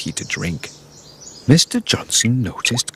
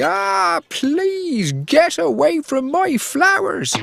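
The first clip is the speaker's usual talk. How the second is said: much higher, slow drawn-out shout; high slow cry to scare the puppy off; slower than usual. A narrator's reading. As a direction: wail it high and slow from the window. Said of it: "shouted very loudly".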